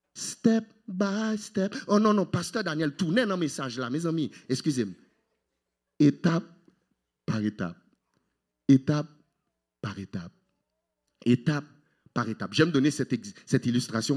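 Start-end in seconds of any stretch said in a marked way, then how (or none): 0.1-1.7 very slow, drawn-out words; step by step. Shown as a demonstration. Preacher shows how God leads, very slow and drawn out. Acts out gradual guidance. 6.0-12.5 halting "Étape... par étape", a pause after every step. Shown as a demonstration. Preacher illustrates gradual progress, "Étape" lifted, "par étape" sinking after a pause. Acts out steps, pausing between.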